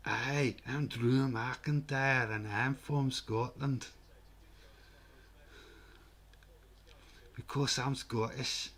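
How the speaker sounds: slow put-on Scottish accent, a wrestler introducing himself; drawn-out mock Scottish brogue; lightly hammed Scottish accent; Drew McIntyre Scottish accent. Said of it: "They're sending Drew McIntyre around".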